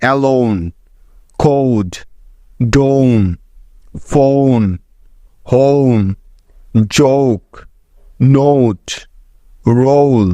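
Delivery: each word in ফোন, হোম, রোজ said slowly with a gliding "oh-oo" vowel; teacher modelling English words with a paused beat between each; clear model pronunciation. Shown as a demonstration. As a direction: carefully model the ও vowel in ফোন as a full "ow" glide, one word at a time. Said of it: "যেমন দেখো".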